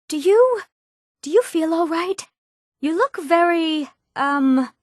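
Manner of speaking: uneasy high-pitched concern; worried, hesitant. Acted. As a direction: falter upward as you check on your spell's victim. Mage alarmed at spell gone wrong.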